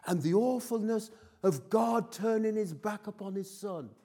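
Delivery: high, loud preaching over the congregation; fervent preaching. Real, not acted. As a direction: lift the voice and drive it home from the pulpit. Preaching at full force.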